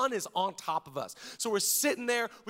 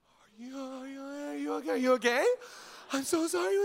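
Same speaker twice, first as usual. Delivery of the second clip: panicked concern; 6 semitones higher than usual. Retold or imitated. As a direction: check on your hurt wife in a rush. Ball hits wife, he panics.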